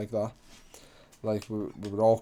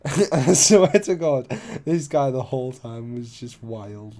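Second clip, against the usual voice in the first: laughing; 6 dB louder, slower than usual. Real, not acted. Telling a wild story about the mate, laughing. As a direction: laugh while insisting it is true.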